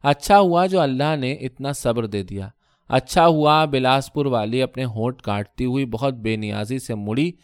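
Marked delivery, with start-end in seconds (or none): none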